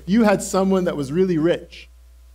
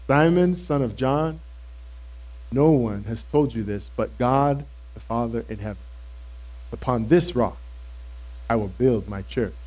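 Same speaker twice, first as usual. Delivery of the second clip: Jesus; 6 semitones lower, 3 dB quieter than usual. Retold or imitated. Jesus voiced in the retelling.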